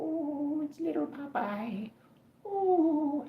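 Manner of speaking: high, soft cooing; cat cooing. Acted. Owner cooing at little Popeye, high and soft. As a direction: coo at the cat.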